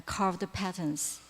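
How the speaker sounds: ordinary delivery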